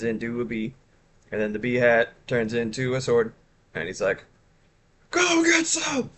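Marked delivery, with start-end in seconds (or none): 5.1-6.1 much higher, slow cartoon battle cry; Charlie's voice. Streamer voicing her character Charlie taunting a foe. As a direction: squeak it out like a tiny brave hero. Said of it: "He talks like this".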